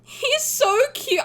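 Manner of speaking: high, slow, swooning he's so cute; gushing squeal. Real, not acted. Fan gushing at her favourite character, high and slow. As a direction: melt over him, drawn out and high.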